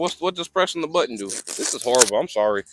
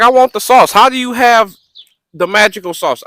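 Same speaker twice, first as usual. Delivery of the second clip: loud, raised retold plea; worked-up pleading; 7 semitones higher, 12 dB louder than usual. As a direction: retell it as an eager, high demand. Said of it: "I'm like".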